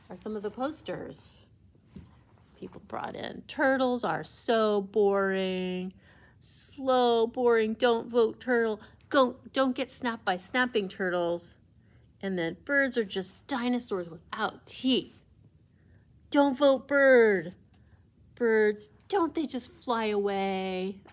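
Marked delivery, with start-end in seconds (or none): 3.5-11.6 loud, slow campaign-slogan chant; poster slogan shout. Acted. Reading campaign posters aloud. 12.6-21.0 loud, slow and mocking slogan; campaign slogan shout. Reading anti-bird poster aloud.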